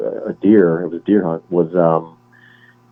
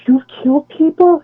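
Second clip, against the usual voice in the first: much higher, a startled wife's gasping question; Jamie Lee Curtis, shocked; 4 dB louder than usual. Retold or imitated. Retelling the True Lies scene, imitating the shocked wife. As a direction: imitate a stunned wife finding out her husband kills. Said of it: "she's like".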